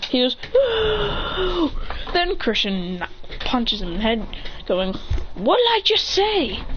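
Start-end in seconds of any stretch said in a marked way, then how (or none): 0.4-1.7 gasped high "No"; startled gasp. Acted. Acted startled reaction in story. 5.3-6.6 high, drawn-out acted telling-off; scolding. Character scolds after punching.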